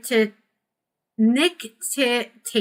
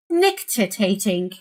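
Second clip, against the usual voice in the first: "Nictitating" said slowly and carefully, each syllable clear; practising a word; slower than usual. Shown as a demonstration. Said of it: "I don't know how to say that word".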